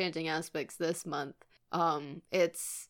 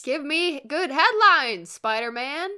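J. Jonah Jameson shout; 7 semitones higher, 6 dB louder than usual. Acted. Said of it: "J. Jonah Jameson quote".

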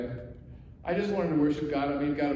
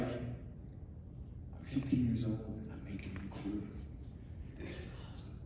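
put-on hushed, thrilled teen whisper, much softer; breathless awed whisper about recording; excited whisper; 5 semitones lower, 12 dB quieter than usual. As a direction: whisper it like a kid secretly delighted. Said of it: "And I'm like".

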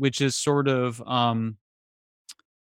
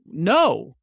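a firm, louder no; higher, louder no, shutting it down; flat refusal; 4 semitones higher, 5 dB louder than usual. Retold or imitated. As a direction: put your foot down. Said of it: "And you're like".

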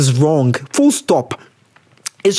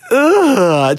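long high "ugh"; disgusted groan; 6 semitones higher than usual. Real, not acted. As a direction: groan in exaggerated revulsion. Disgusted by the letter.

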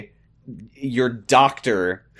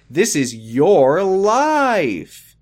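slow, drawn-out, high dramatic line; milk it like a soap-opera climax; mock dramatic verdict; 6 semitones higher, 4 dB louder, much slower than usual. Mother lecturing pregnant daughter, slow mock-dramatic verdict.

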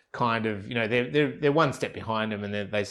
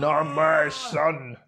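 higher, louder, very slow melodramatic plea; hammed-up melodrama; 5 semitones higher, 4 dB louder, much slower than usual. Parodying the father's rescue line, hammed-up and slow. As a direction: ham it up like a soap hero.

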